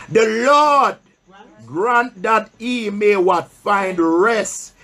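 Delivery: solemn pulpit Bible reading; scripture reading. Acted. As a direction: read the verse slowly to the church, pausing for answers. Preacher reads scripture aloud.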